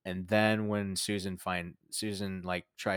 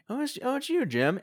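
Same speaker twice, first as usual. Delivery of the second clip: fake delight. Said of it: "he just".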